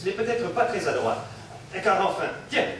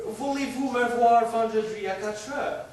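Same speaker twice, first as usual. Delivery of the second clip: slow put-on English accent, a little higher; 6 semitones higher than usual. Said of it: "Il a l'accent anglais!".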